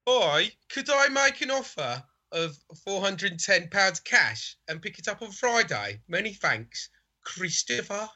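put-on Brummie accent, mock-refined; sophisticated Brummie. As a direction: read the message as a would-be sophisticated Brummie. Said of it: "I'm going sophisticated Brummie".

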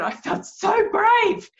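high, loud and slow proud declaration; proud and thrilled. Retold or imitated. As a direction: savour each word like a victory. Client proud after facing fear.

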